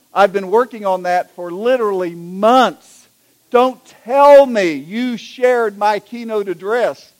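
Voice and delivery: loud, rising indignation, slowing down; acted-out outrage, stressed word by word; dismayed bishop. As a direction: build to a slow, horrified accusation. Bishop learns his speech was used.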